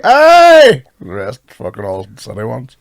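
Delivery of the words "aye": loud, high yelled "Aye!"; crowd's shout. Retold or imitated. Comedian imitating the audience's loud reply. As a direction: bellow it like a cheering crowd.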